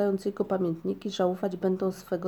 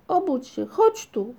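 calling out; 6 semitones higher, 5 dB louder, slower than usual. A narrator's reading. Calling his wife to wake.

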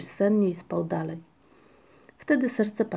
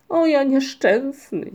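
slow, loud wail of woe; moaning lament; 6 semitones higher, 8 dB louder, slower than usual. A narrator's reading. Paszczak wakes in a flooded hole, moaning slowly. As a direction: moan it out, drawn long. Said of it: "jęknął Paszczak półgłosem".

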